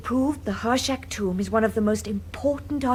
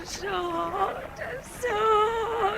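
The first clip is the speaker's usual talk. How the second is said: faint, slow, dazed moan of heat; delirious murmur; 9 semitones higher, 6 dB quieter, much slower than usual. Acted. Drugged woman rescued from boat, delirious slow moan. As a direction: drift in and out, half conscious.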